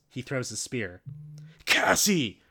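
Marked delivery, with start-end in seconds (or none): 1.6-2.4 loud, high alarmed cry. Acted. Rachel crying out as the spear flies at Cassie. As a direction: warn her in sudden fear. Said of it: "Rachel cries".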